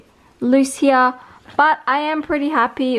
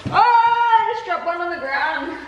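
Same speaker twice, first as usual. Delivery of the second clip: surprised; 6 semitones higher than usual. Real, not acted. Drops a potato mid-sentence.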